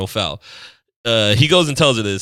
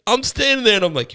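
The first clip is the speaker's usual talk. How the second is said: ordinary talk, nothing marked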